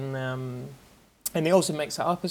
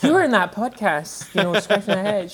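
higher, louder mock-impressed interviewer; playful, laughing retelling of an eager boss; Robin; 7 semitones higher, 8 dB louder than usual. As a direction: imitate an interviewer hiring on the spot. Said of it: "Robin was just like".

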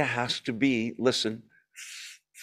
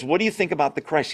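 "What do you think about the Christ?" rattled off fast; quick quote; 2 semitones higher, faster than usual. Acted. Preacher quickly re-quoting Jesus' question before moving on, fast. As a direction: run the question off in passing.

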